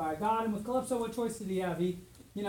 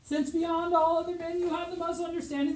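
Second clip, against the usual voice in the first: a hint of pleading wife, higher; lightly voiced Penelope; 9 semitones higher than usual. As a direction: gently plead with your husband. Voicing Penelope.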